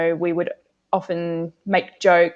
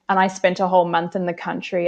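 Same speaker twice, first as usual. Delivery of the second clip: normal talking voice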